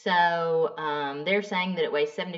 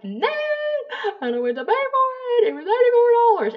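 much higher and louder, fast indignant complaint; angry high rant; 8 dB louder than usual. Retold or imitated. Woman fuming at home over the bill, high loud rant. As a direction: wail it like you've been robbed.

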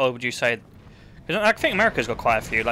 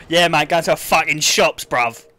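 louder mock Cockney of the local lads; rough Cockney accent; 3 semitones higher, 6 dB louder than usual. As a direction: imitate a less eloquent local in Cockney. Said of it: "Some people in my area are like".

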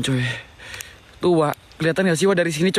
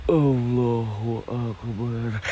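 very slow, low invocation; drawn-out invocation; 6 semitones lower, 3 dB quieter, much slower than usual. Real, not acted. Ghost hunter invoking God alone, very slow and low. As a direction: stretch each word out, low. Awed dread during night ghost hunt.

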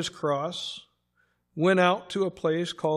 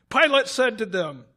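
plain reading voice

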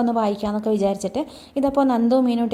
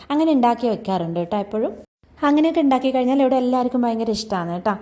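usual speaking voice

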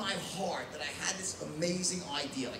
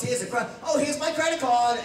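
eager buyer; 5 semitones higher, 6 dB louder than usual. Retold or imitated. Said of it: "Bidding starts".

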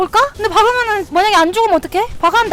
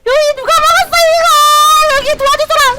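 high, loud frantic call; calling for help; 9 semitones higher, 5 dB louder than usual. Real, not acted. Streamer shouting for teammates' help, frantic call. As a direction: shout out to the team for backup.

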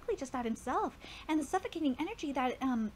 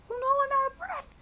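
higher, louder breathless panic; panicked gasp; 12 semitones higher, 7 dB louder than usual. Acted. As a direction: fight for air in a panic. Drowning panic in inner monologue.

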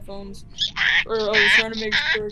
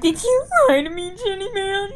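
mock wail; 9 semitones higher, 7 dB louder than usual. Mock betrayal outcry.

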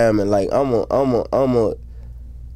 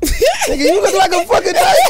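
laughing; 4 dB louder than usual. Real, not acted. Laughing at friend's look.

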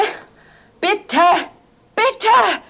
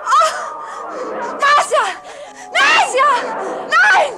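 terrified calling; 4 semitones higher, slower than usual. Acted. Woman calls desperately for Marcia, terrified screaming. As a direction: scream her name as if she's being dragged away.

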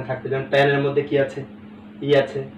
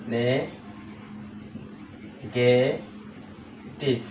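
"Negative" very slow and soft, as নে, গে, টিভ; long pauses between the three syllables of "negative"; 2 semitones lower, 8 dB quieter, much slower than usual. Shown as a demonstration. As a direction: break "negative" into three drawn-out pieces. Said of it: "ভাগ ভাগ করে বলতে পারছি".

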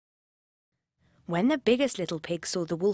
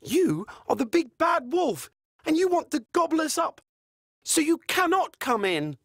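higher, quicker defiant pigs; little pigs' voice; 9 semitones higher than usual. Acted. Little pigs refusing the wolf, higher and defiant. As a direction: call him out, high and sure.

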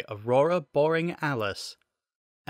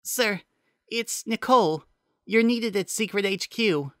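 Nicole's voice; 11 semitones higher than usual. Acted.

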